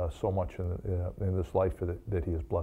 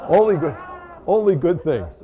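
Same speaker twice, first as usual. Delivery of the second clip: mock protest; 7 semitones higher, 8 dB louder than usual. Real, not acted. Mock protest after his joke.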